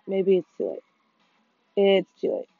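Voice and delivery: ordinary talk, nothing marked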